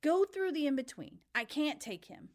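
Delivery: high, loud sudden outburst; exclaiming. A narrator's reading. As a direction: blurt it out urgently. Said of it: "Charon sat up and exclaimed".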